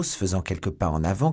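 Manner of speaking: usual speaking voice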